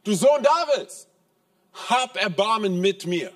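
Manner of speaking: high, loud, slow plea to Jesus; the blind beggar crying out to Jesus from the crowd. Retold or imitated. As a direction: call out desperately for mercy. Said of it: "Er schreit, er ruft".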